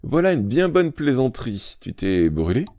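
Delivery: a hint of sarcastic amusement; mocking tone. A narrator's reading. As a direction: tease him, you don't believe a word. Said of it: "d'un ton qui signifiait".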